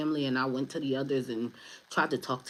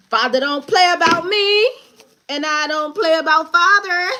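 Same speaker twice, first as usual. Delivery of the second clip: high, loud, ringing proclamation; triumphant shout; 13 semitones higher, 10 dB louder than usual. Real, not acted. Boasting that Father protects the speaker, triumphant holler.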